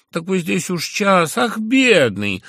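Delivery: high, slow, loud mock pity; mocking exclamation. A narrator's reading. Zverkov mockingly pities the narrator for waiting an hour. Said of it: "вскрикнул насмешливо Зверков".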